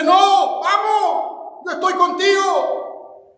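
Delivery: urgent shout of "don't give up"; Holy Spirit urging. Acted. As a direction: call out like the Spirit lifting a fallen believer. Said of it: "el Espíritu Santo dice".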